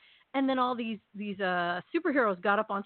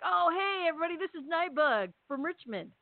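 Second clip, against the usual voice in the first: imitating a cheery, higher-pitched introduction; 7 semitones higher than usual. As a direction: present a pal to the crowd. Voices hero introducing Nightbug to crowd.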